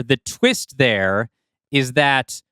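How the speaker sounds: usual speaking voice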